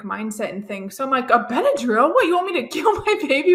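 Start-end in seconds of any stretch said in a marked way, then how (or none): 1.3-3.5 high, fast, laughing overdone shock; mock dramatic outrage. Mocking her past self to the podcast host, overdramatic. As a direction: ham up the horror of a pill. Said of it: "so absurd and, like, dramatic".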